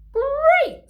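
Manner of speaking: very high, loud joyful cry; big smile. A narrator's reading. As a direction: burst out with delight. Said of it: "replied with a big smile".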